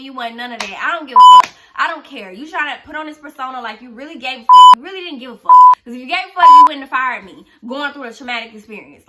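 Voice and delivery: loud, heated rant at the boss; furious rant. Real, not acted. Venting to viewers about the boss who fired her, shouting angrily. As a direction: let the anger blow out loud.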